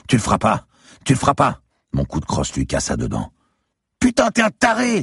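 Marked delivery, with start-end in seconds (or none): 0.1-1.6 high, loud challenge, rising on the repeat; defiant cry. A narrator's reading. Woman to the gunman threatening her, defiant cry. 4.0-5.0 very high, loud female scream. A narrator's reading. Girl to the man who hit her boyfriend, screaming. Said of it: "hurla la fille".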